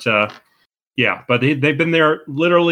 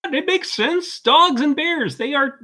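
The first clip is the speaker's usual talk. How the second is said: much higher, smug pompous certainty; mock authority; 13 semitones higher than usual. Podcaster jokingly insisting dogs and bears are friends, pompous. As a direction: state it like an obvious fact. Mock-serious bogus claim.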